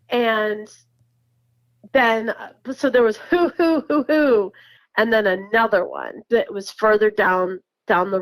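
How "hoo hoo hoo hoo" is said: mock owl hoots. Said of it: "the worst barred owl imitation you ever heard".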